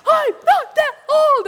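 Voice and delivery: very high, loud, slow protest; indignant yell. Retold or imitated. Swimmer to rescuers who called her ma'am, outraged yell. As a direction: yell each word out slowly, offended.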